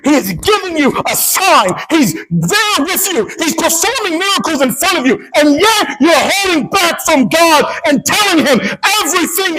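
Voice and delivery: high, insistent exhortation; impassioned preaching. Real, not acted. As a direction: drive each point home in a high pulpit voice. Preaches at full force.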